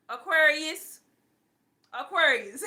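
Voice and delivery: loud, high, laughing call of Aquarius; exasperated amused outburst. Real, not acted. Mocking son's zodiac sign.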